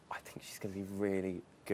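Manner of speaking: hushed, breathy whisper of a private thought; whispered aside. Retold or imitated. Host recalling his reaction to Katie Hopkins, whispered. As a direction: whisper it like a secret. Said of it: "that's when I went".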